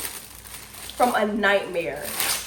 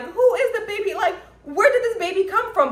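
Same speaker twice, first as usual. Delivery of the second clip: bewildered; 10 semitones higher, 3 dB louder than usual. Retold or imitated. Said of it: "And I was like".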